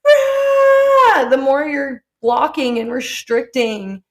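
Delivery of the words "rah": high, loud, drawn-out "rah"; hammed-up wail of frustration; mock freak-out scream. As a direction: imitate a tantrum yell. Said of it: "you're sitting there like".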